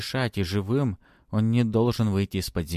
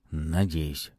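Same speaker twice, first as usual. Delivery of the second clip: low, doubtful one-word reply; lowered voice, full of doubt; 4 semitones lower than usual. A narrator's reading. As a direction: say it with little faith. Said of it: "с сомнением в голосе произнёс".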